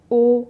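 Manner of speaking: teacher belting back vowels; loud rounded vowel demo. Shown as a demonstration. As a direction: round the lips and belt ओ, औ. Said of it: "ये क्या है".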